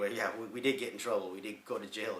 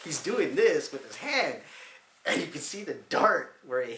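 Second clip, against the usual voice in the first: laughing; 7 semitones higher, 10 dB louder than usual. Real, not acted. Laughing retelling the dart accident.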